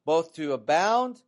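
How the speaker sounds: emphatic scripture reading with swelling key words. Acted. Preacher reads Philippians to congregation, emphatic scripture reading. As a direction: read the verse out firmly to a congregation.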